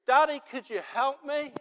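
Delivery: high, loud plea to Daddy; child's cry for help. Retold or imitated. Child to Daddy in a moment of need, high pleading cry. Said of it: "there's a cry that goes up".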